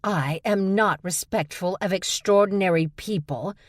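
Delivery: Tony barking back at Stevie in fury; angry bark. Acted. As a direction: snap it back, shaking with anger. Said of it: "she barked".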